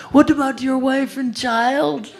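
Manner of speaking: very high, loud panicked pleading; shrieking plea to a friend walking into the jungle; terrified scream. Retold or imitated. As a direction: scream it like he's begging for his life. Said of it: "and Damodar is screaming".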